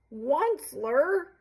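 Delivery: high croaky creature cry; croaking Lorax. A narrator's reading. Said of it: "he cried with a crofulous croak".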